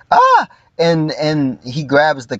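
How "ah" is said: sudden high-pitched yelp of shock; startled yelp. Acted. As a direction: jolt out a short cry as if caught off guard. Acted shocked cry in recap.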